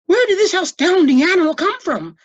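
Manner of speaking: high, loud amazed question; astonished cry. A narrator's reading. Librarian amazed by the monster, loud and high. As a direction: gasp it out in wonder. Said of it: "cried Miss Severs".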